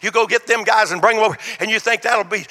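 ordinary talk, nothing marked

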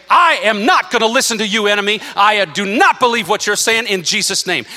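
loud, high, defiant rebuke. Shown as a demonstration. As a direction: shout down the enemy with full force. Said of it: "then you gotta get louder".

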